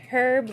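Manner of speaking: "herb" with the H of Herbert; H pronounced. Retold or imitated. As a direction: quote a coworker saying "herb" with a sounded H. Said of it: "like Herbert".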